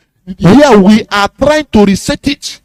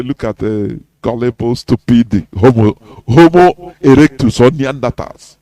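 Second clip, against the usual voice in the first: lower, scornful mock of a laughing foreigner; looking down on them, in a lower voice; 6 semitones lower than usual. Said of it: "Oyibo man will be laughing, say".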